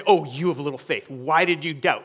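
mild rebuke in a plain speaking voice; disappointed reproach; reproachful reading. Retold or imitated. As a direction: chide them at ordinary volume, pitch dropping. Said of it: "That's not how I read it".

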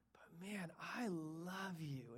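slow, whispered and soft; tender whisper. Acted. God whispering love to his disciplined children. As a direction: breathe it gently like a loving father.

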